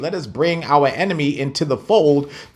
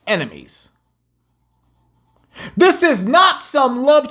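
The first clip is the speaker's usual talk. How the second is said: preaching; 8 semitones higher, slower than usual. Acted. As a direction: preach it to the congregation, rising and pressing. Preacher reads scripture, exhorts.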